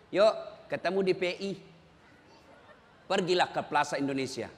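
low, soft gossiping invite; hushed conspiratorial invite to the mall; wife chatting quietly. Retold or imitated. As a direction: murmur it like a sly group chat. Wife invites friends via WhatsApp.

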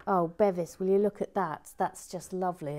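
ordinary talk

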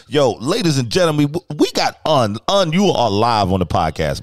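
loud, high hype-man introduction; hyped live podcast intro; excited announcement. Real, not acted.

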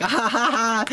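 ha-ha it out like Logan mocking; hammed-up mocking laugh. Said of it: "Logan starts laughing like".

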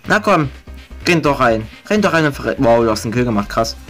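normal talking voice

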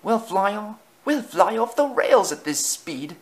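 high-pitched frantic warning; alarmed cry. A narrator's reading. Coaches fearing derailment at speed, alarmed cry. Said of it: "cried Annie and Clarabel".

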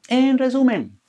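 high, loud, ringing "En resumen"; emphatic announcement. Acted. Host to viewers, opening the summary with a bright call-out. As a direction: sing out the summary heading with energy.

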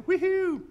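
high, loud "woohoo"; playful whoop. Acted. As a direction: whoop with mock old-school pride. Celebrates owning an old cassette.

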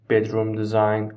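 ordinary talk, nothing marked

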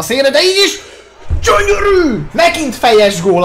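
excited goal shout. Real, not acted. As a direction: yell with delight at the goal. Goal celebration while playing.

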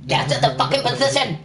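urgent mock command; mock shouted order. Mimicking a teammate's shouted order.

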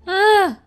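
short, loud high gasp; startled gasp. Acted. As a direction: gasp in sudden fright. Startled in spooky place.

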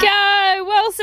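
high excited cheer calling the dog. Real, not acted.

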